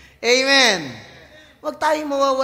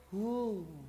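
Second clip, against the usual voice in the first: a limp, much softer woo; weak woo; 3 semitones lower, 12 dB quieter than usual. Shown as a demonstration. Preacher mocking a powerless, feeble cheer. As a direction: let the cheer fall flat with no power. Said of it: "walang ka-power-power".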